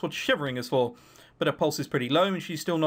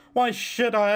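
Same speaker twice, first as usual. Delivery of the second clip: usual speaking voice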